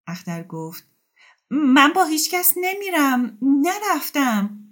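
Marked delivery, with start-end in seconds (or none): 1.5-4.6 high, loud denial, last word dragged; indignant woman's protest. Acted. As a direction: deny it hotly.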